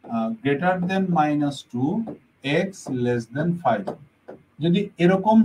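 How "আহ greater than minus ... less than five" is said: very slow and careful; slow read-out. Shown as a demonstration. Teacher reads an example inequality to students, slowly. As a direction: read each word slowly. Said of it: "একটা মান আমরা এরকম দেখব".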